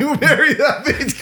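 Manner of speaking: laughing. Real, not acted. Laughing mock repeat.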